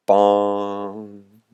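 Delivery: long hollow bong; imitated bell toll. Storyteller voicing the bell tolling in the sky, drawn-out bong. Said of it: "A bell tolled in the sky".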